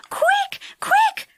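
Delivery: high, urgent whispered cry; Silky crying out in alarm. A narrator's reading. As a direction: gasp it out high and hurried. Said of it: "cried Silky".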